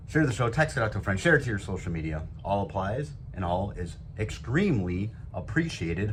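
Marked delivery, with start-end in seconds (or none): 0.1-2.2 faster insistent repeat. Shown as a demonstration. Host repeats the request fast to a stubborn listener. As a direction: rattle off "Share the show" fast and pushy. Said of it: "What's wrong with you?".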